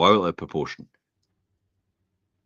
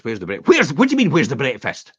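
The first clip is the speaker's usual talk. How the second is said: mock angry husband; 5 semitones higher, 7 dB louder than usual. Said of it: "and he goes".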